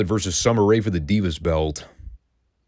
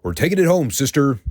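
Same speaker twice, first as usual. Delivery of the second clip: higher, hyped-up mock rallying call; mock pep talk; 6 semitones higher than usual. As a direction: play it as a cheesy partner rallying her teammate.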